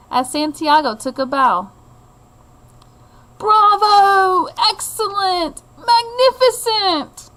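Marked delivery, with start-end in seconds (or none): 3.4-7.3 high, loud cheers, slowing on the last; cheering crowd. Acted. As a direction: shout praise from the seats. Crowd acclaims performance.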